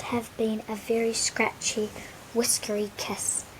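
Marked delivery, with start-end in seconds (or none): none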